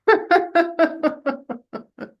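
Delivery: real laughter bubbling over; loud high laugh. Real, not acted. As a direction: burst of high-pitched laughter. Laughing at Jen's amusement.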